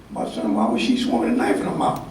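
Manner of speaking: a puzzled father answering softly; a touch of a deep-voiced patient father.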